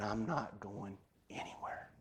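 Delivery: soft voiceless whisper; whispered promise. Retold or imitated. Preacher quoting his wife's promise to stay, whispered. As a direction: breathe it out tenderly.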